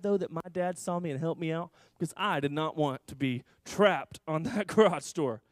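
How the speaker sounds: normal talking voice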